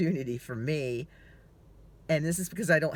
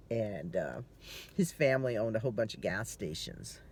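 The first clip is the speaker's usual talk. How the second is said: usual speaking voice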